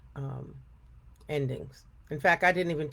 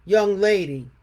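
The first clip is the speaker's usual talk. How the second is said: loud scolding bear voice; stern Papa Bear; 8 dB louder than usual. A narrator's reading. Papa Bear scolding the intruding girl, loud. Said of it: "said Papa Bear".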